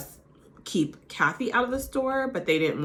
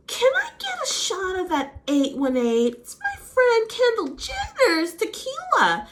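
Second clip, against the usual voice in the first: high, slow, sugary imitation of Rena; higher, slower put-on voice showing off; mock Rena; 14 semitones higher, 4 dB louder, slower than usual. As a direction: ham up Rena bragging about her friend's tequila. Recap mimics Rena ordering shot.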